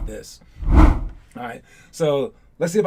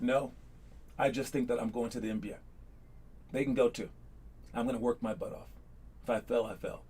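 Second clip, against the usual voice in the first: much softer, calm and modest; calm, not cocky; 6 semitones lower, 13 dB quieter than usual. Retold or imitated. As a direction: say it quietly, without arrogance. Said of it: "You don't say, say it in a cocky way or any kind of mean way".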